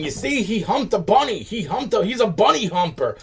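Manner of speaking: high, teasing sing-song mockery; mocking gossip. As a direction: mimic people spreading a rumour with a sneer. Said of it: "running around fucking saying".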